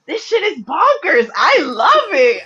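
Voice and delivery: high, slow, loud gushing; thrilled reader. Retold or imitated. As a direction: rave like you've just found a favourite book. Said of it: "and I was like".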